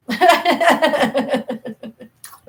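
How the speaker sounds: high, bubbly giggling; giggle fit. Real, not acted. Laughing about her son naming the cat, giggling. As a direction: laugh it off fondly.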